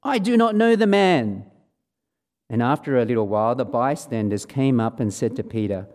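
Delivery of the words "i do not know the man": higher, louder insistent denial; firmer denial with an oath. Acted. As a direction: deny it hard under oath. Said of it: "again he denied it with an oath".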